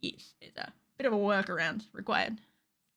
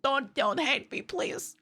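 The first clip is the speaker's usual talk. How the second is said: usual speaking voice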